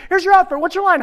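very high, loud rapid-fire questions; frantic scolding. Acted. Crew backstage to late actor, frantic scolding. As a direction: pounce on the latecomer in panic.

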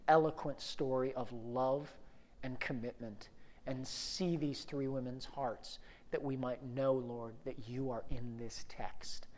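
hushed, low public prayer; reverent prayer. Real, not acted. Preacher praying over the text, low and reverent.

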